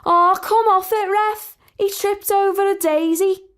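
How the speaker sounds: high, loud outraged Scouse yell; screech at the ref. A narrator's reading. Sally screeching at the referee on screen, loud. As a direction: scream at the telly. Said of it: "Sally screeched at the screen".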